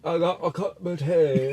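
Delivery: high, put-on British voice; mock British accent. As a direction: do a hammed-up Brit. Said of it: "why the Brits always call it tea".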